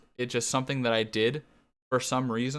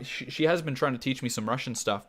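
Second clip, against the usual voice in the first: normal talking voice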